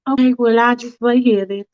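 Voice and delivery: a fussy woman hedging politely; Faith's fussy voice. Acted.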